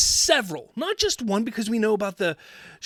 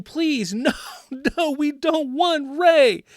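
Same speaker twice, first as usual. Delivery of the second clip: higher, louder, slow mock pleading; pleading fans; 7 semitones higher, 5 dB louder, slower than usual. Fans begging Lucasfilm, slow hammed-up pleading. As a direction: beg like a desperate fan, half laughing. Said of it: "Fans yelling, pleading".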